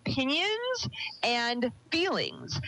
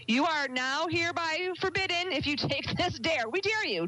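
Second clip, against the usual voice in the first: playful dare; 6 semitones higher than usual. Real, not acted.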